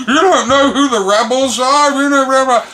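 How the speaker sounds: high, loud mocking whine; mock the indignant friend on the phone; mocking Johnny. Mimicking Johnny on the phone, high and loud.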